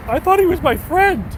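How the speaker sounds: high, mock-wounded Jay voice; high mock hurt whimper; hurt friend. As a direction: squeak it out like a betrayed friend. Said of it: "Jay's like".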